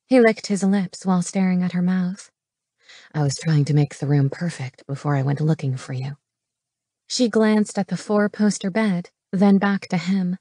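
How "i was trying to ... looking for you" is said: low man's voice, earnest; low-voiced romantic hero; male lover. A narrator's reading. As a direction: drop the pitch for the hero's confession.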